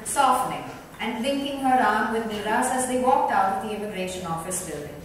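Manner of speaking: usual speaking voice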